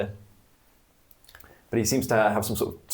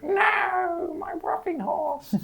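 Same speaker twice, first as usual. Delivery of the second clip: high squeaky goblin cry; squeaky goblin wail; slower than usual. Acted. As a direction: wail it like a heartbroken little goblin. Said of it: "He's like".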